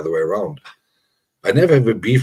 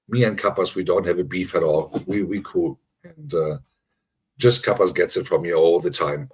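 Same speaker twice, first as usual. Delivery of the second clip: ordinary talk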